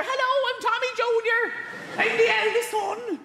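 put-on very high Irish child's voice; Tommy Junior. Eldest son saying hello, high Irish falsetto. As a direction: pipe up as a small Irish boy.

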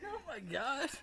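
laughing. Real, not acted. Laughing, high pitched.

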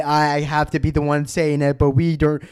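normal talking voice

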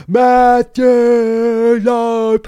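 booming, high British ring announcer; drawn-out British announcer yell; British accent. Acted. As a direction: shout the name out in a British accent. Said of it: "I did a British accent".

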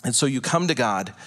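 usual speaking voice

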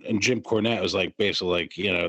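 ordinary talk, nothing marked

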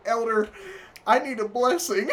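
high, loud acted pleading; imitating a man begging urgently; mock desperate plea. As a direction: plead for help, hammed up. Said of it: "And he'd be like".

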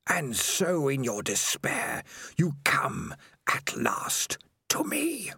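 slow, ghostly whisper from the dark; a creeping, hissing whisper of a lurking monster; eerie spider voice. Acted. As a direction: breathe it like a spider in his head. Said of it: "the voice of Spider".

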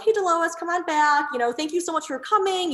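high, bright-eyed welcome to a returning client; excited greeting. Retold or imitated. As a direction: greet her like you're thrilled to see her. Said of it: "how excited you are".

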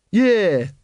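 high, emphatic follower; Watchtower. A narrator's reading. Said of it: "said Brother Watchtower".

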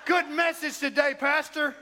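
mock an overeager congregant praising the pastor; Jason Ray. Churchgoer praises the pastor after service, hammed-up whoop. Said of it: "Jason Ray would do that".

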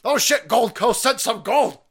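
high, loud mock surprise on "Oh, shit"; surprised exclamation. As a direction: gasp it out like the box just opened. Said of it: "And I was like".

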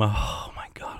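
breathy whispered aside. Real, not acted. Whispering to herself, heart pounding, half to the mic. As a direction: whisper it to yourself, rattled.

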